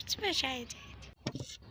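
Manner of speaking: usual speaking voice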